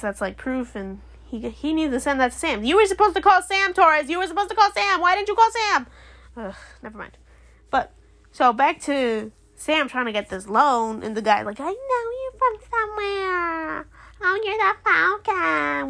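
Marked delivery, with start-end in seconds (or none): 2.6-5.9 higher, urgent mock scolding; worked-up fan telling off a character; exasperated scolding. 11.6-15.9 high, drawn-out mocking imitation; stretched-out caricature of someone recognizing a celebrity; put-on banker voice. Acting out the banker recognizing Sam.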